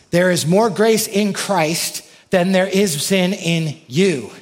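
fervent pulpit build; climactic emphasis. Acted. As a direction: build and hit the line. Preacher's climactic claim.

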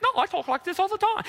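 very high, rushed fake voice; badly faked voice to hide who you are; hammed-up squeaky denial; disguised voice. Peter faking another accent when accused.

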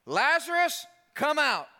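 high, loud call by name. Retold or imitated. Jesus at the grave calling Lazarus by name, loud high call. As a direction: summon him out, full voice. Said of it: "he calls him by name".

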